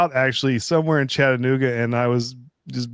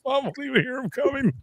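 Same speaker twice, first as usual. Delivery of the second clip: much higher, fast mocking repeat; mocking dad; 13 semitones higher, 3 dB quieter, faster than usual. Friend mocking dad's cruel joke, high mocking voice. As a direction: parrot his line in a silly high voice. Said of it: "I was like, "You're terrible".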